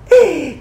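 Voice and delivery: high, loud wicked cackle; evil cackle. Acted. Circe cackling in triumph over her guest. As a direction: cackle like a witch. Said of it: "la risata cattiva".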